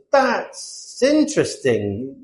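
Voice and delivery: very slow, drawn-out "that's interesting"; questioning British politeness. Acted. British person questioning someone's choices, slow sceptical politeness. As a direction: stretch it out to imply doubt. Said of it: "So if a British person says".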